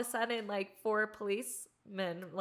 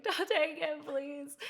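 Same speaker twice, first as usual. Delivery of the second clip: higher, pleading plea not to take it; pleading teen; 8 semitones higher, 4 dB louder, slower than usual. Retold or imitated. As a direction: beg like a scared kid losing her camera. Begging police for camera.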